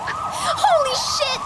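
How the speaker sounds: high, drawn-out shocked cry. Acted. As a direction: gasp it out in disbelief. Sees giant sandwich.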